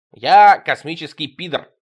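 loud, slow offended outburst; offended alien booming his identity; offended declaration. Acted. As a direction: proclaim it loud and slow, wounded pride. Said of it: "обиделся Тимоха".

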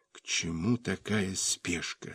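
weak whispered question; barely-there whisper, straining for voice. A narrator's reading. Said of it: "Разумеется, шёпотом".

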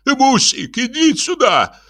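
very high, panicky call; high-pitched mock shout of fright; frightened cry. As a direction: call the wife for help in alarm. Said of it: "закричал испуганный инженер".

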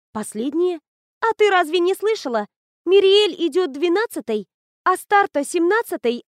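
high, loud, eager telling; excited. Acted. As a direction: rattle off the news, thrilled. Friend explains excitedly.

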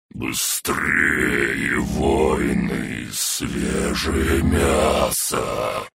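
a ghostly chant echoing in the head; voice inside skull. A narrator's reading. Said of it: "Голос внутри черепной коробки".

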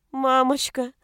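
put-on high little-girl call; plaintive whimper. A narrator's reading. Distressed daughter calling mother, whimpering. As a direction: whimper for mummy. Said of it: "Жалобный скулёж Кати".

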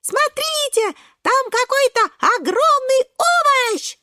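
very high, excited squealing; astonished shout. Acted. Шпинатик spotting something huge, very high excited cry. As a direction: squeal the discovery to everyone. Spots a huge creature swimming up.

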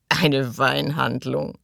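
slow, drawn-out mocking echo; chuckling scorn. A narrator's reading. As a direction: stretch it out with an amused sneer. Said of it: "gluckste Thug". (langsam und glucksend spöttisch)

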